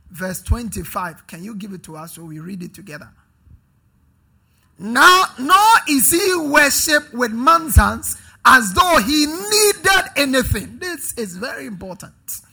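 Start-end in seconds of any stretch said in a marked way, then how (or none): 4.8-10.8 slow, loud, high proclamation; preaching scripture reading. Real, not acted. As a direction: declaim the verse to the congregation. Reads scripture at full force.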